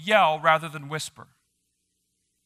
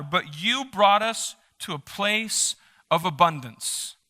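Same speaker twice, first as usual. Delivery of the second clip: raised, solemn psalm reading; scripture reading; 3 semitones higher, 3 dB louder than usual. Acted. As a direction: proclaim the verses to the congregation. Reads psalm aloud.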